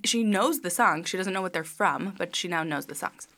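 ordinary delivery

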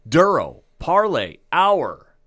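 slow, drawn-out host introduction; big welcome with long pauses and punched words; show announcer. Acted. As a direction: stretch each title out like an announcer.

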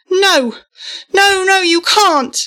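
high, loud, desperate cries of no; desperate protest. A narrator's reading. Boy begging Mum not to close the skylight, desperate and loud. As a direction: beg them to stop, panicking.

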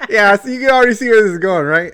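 can barely get the words out for laughing; laughing at herself as it starts to go wrong. Real, not acted. Laughing while telling the story.

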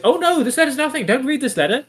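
very high, hurried sitcom squeal; panicked son. Retold or imitated. Son hiding a letter from father, comic panic. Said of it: "feels like a sitcom".

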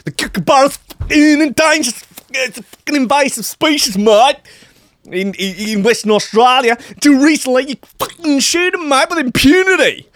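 Ross Williamson's broad Aussie rant. Retold or imitated. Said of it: "Ross Williamson told Nine News Perth".

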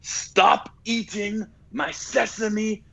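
very high, slow, menacing put-on voice; stretched, high mock warlord warning; Delroy Lindo impression. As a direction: drag out the threat in a strange, high villain voice. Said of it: "this is the most memed part of the movie".

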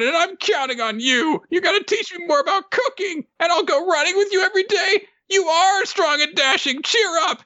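very high, impassioned cartoon pep talk; Nobuteru. Creature urges collapsed Ren to rise, high and fervent. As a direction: squeal encouragement, building to a shouted plea. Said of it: "And Nobuteru says".

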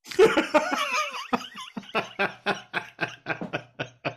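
high long burst of laughter; real helpless laughter; big laugh. Real, not acted. Host cracking up at the punchline, high long laughter.

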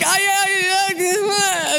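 high, frightened stammer; stammering kid. Retold or imitated. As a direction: stutter like a caught child. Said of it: "And I'm like".

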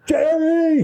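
high yelled call of Jerry; shouted name. Retold or imitated. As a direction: bark his name across the stage. Bandleader yelling at drummer.

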